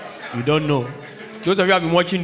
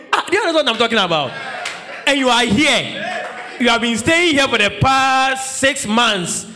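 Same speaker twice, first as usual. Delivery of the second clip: preaching at full force; 5 semitones higher than usual. Acted. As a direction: call it out from the pulpit, teasing the ladies. Preacher shouting at congregation.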